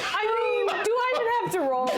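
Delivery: high exasperated laughing. Real, not acted.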